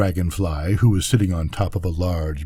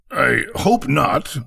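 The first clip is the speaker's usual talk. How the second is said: slow, self-satisfied reply; mild complacent frog; 5 semitones higher, slower than usual. Acted. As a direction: say it smugly, taking your time. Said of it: "said the Frog complacently".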